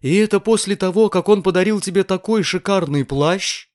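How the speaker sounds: high, scandalised landlady; indignant, incredulous outburst; exclaiming. A narrator's reading. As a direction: protest in high-pitched disbelief. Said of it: "воскликнула госпожа Бенсусон".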